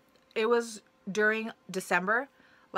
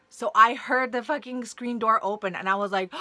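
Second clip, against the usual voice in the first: ordinary talk, nothing marked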